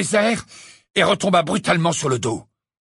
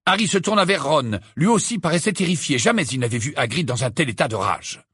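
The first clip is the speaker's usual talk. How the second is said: ordinary delivery, nothing marked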